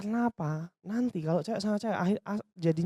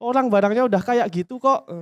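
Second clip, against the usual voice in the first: mock snappy retort; 2 semitones higher, 7 dB louder than usual. CS snapping back at customer.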